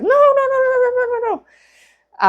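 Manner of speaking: very high rapid-fire squeal of protest; baby chickens. Retold or imitated. Imitating baby chickens that won't be picked up, high squealed protest. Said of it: "baby chickens are just like".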